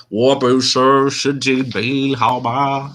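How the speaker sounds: put-on high, slow Mandarin in an American accent. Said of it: "I totally said it totally embarrassed".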